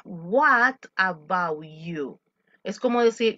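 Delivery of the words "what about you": slow model line. Shown as a demonstration. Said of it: "model dialogue".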